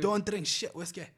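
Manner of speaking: slow mock Scottish; Scottish accent aimed at Irish, missing; Scottish-sounding accent. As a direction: stretch it out in a put-on Scottish accent. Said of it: "that sounds more Scottish".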